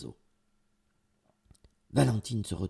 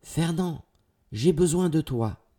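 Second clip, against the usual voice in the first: high, louder summons; raised, appealing call; calling for help; 7 semitones higher, 4 dB louder than usual. A narrator's reading. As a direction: call out to a faraway friend. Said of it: "en disant".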